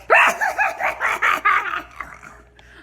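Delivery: loud, high imitated cackle; hyena cackle. Wife mimicking husband laughing as she panics, hyena cackle. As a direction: burst into a shrieking cackle. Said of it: "like a hyena on drugs".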